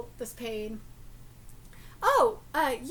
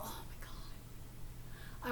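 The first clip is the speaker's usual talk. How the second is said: much softer, slow breathy whisper; a very slow, hushed sigh of longing; 16 dB quieter, much slower than usual. Real, not acted. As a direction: whisper it like a guilty craving. Longing for salmon.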